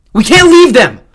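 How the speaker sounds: high, loud desperate yell. A narrator's reading. The three yelling at Xander together, loud. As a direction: plead at full voice. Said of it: "all three yelled at once".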